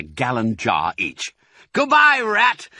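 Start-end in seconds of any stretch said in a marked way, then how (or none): 1.7-2.7 high, loud cheeky farewell; the foxes tease Rat as they flee; calling out. Acted. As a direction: call it back over the shoulder. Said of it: "they called out".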